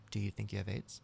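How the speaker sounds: quiet, grave doctor's question; all seriousness. Retold or imitated. Doctor gravely questions a joking patient, quiet and serious. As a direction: drop the volume and ask in earnest. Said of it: "looks at me all seriousness".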